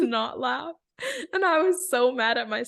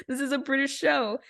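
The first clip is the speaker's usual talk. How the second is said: usual speaking voice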